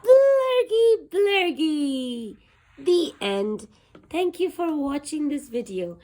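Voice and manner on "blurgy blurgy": loud creature call. A narrator's reading. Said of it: "It's a new friend".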